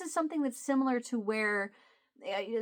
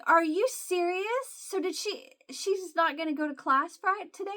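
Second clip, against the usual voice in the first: high-pitched retold disbelief; incredulous; 8 semitones higher than usual. Asking husband about Jalen skipping class, incredulous. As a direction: act out astonished questioning, pitch way up.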